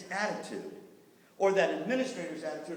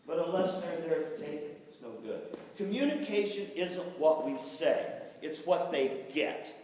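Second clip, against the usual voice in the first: ordinary talk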